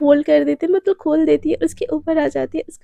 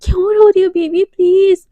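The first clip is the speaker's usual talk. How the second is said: higher, much louder coaxing plea; acted pleading; 4 semitones higher, 8 dB louder than usual. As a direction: plead with your crying sweetheart. Begs crying girlfriend to stop.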